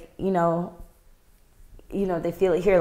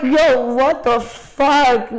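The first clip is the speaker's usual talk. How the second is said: higher, slow drawn-out exclamation; mock outraged disbelief; 4 semitones higher, 5 dB louder, much slower than usual. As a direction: stretch it out in exaggerated disbelief. Retelling own overwhelmed reaction.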